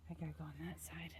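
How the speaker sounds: quick whispered aside to herself; hushed practical aside mid-story. Real, not acted. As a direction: mutter it fast under your breath. Aside while moving camera.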